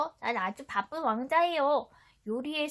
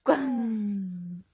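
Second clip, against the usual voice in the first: slow drawn-out 꽝; stamp sound; 3 semitones lower, much slower than usual. Shown as a demonstration. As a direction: stamp it down slowly. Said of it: "도장도". (천천히 길게 꽝)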